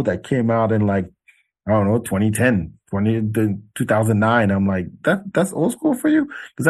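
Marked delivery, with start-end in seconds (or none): 5.0-6.3 put-on high disbelieving that's old school for you; high incredulous scoff at the DJs; incredulous question. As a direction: ask in mock disbelief, pitch way up.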